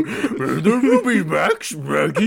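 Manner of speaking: garbled talking-dog voice, high and loud; loud, slurred cartoon dog impression; Scooby-Doo. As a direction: bark out mangled words like a cartoon dog. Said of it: "I read the word Scooby-Doo".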